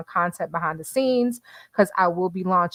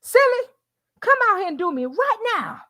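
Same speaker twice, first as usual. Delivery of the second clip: Mister quote; 12 semitones higher than usual. Retold or imitated. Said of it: "Mister's like".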